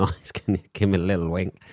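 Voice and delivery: normal talking voice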